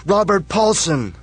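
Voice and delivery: high, loud, slow roaring chant; crowd yell. Acted. Crowds roar the dead man's name, peak chant. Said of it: "the crowds yell".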